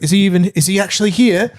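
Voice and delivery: lightly put-on British accent; a hint of a British clerk; mock British voice. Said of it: "he's like".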